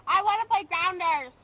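loud, insistent whine; whiny demand. Real, not acted. Girl begs her friend to play Grounders, whining loudly.

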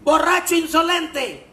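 a shrill, offended rebuke; indignant nun. Acted. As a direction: scold the drunk like an outraged nun. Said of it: "Dice la monja".

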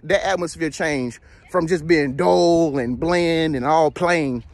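normal talking voice

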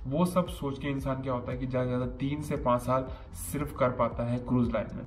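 normal talking voice

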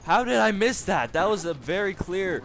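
loud, high exasperated protest; outraged disbelief. Real, not acted. Player protests a clear miss, loud outraged yell.

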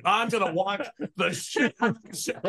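eager viewer. Retold or imitated. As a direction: act out an eager would-be viewer. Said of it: "you'd be like".

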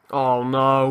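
ordinary talk, nothing marked

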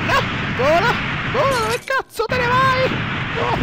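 high panicked yells at the screen; frantic shouting. Real, not acted. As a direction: yell at the character in panic. Yelling at game character.

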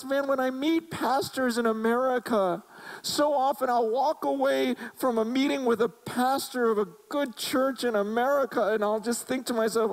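high, impassioned plea to the Lord, imitating; retold tearful lament. Retelling a crying pastor's lament over leaders, imitated anguish. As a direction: plead as the weeping pastor, pitch held high. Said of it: "He's crying and going".

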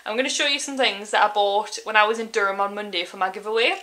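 usual speaking voice

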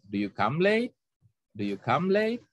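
"late" glides steeply upward; rising question tune. Shown as a demonstration. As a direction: lift the end on LATE like a yes-no question. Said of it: "itu naik".

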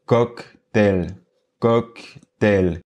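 "cóctel" in two equal, separated syllables; sans accentuation. Shown as a demonstration. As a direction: say "cóctel" slowly, neither syllable stressed. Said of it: "je fais exprès de ne pas mettre d'accentuation". (« cóctel » en deux syllabes égales, sans accent tonique)

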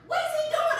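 high, puzzled inner voice; puzzled aside. Retold or imitated. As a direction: wonder aloud what he's up to. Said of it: "And I'm like".

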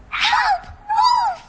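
high, loud scream for help; crying for help. A narrator's reading. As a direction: shriek it out in terror. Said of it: "cried out loudly".

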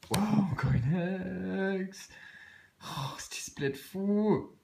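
thrilled. Real, not acted. Collector gushing to viewers over a rare ex card. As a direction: gush over the pull.

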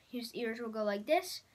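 ordinary talk, nothing marked

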